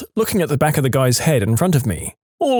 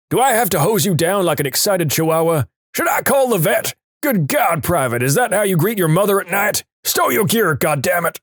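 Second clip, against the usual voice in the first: higher-pitched, scolding rant at a recruit; mock drill instructor yelling; 5 semitones higher than usual. Drill instructor berating a flustered private, hammed-up high yelling. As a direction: rag on him like an outraged drill instructor. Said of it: "starts yelling at him".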